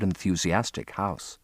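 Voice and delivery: plain reading voice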